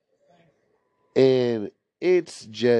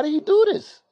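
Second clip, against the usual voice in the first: high baffled squeak; faster than usual. Retold or imitated. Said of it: "So I'm like".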